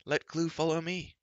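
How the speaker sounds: shouting. Acted. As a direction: call out to draw the giant. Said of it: "Terran shouted".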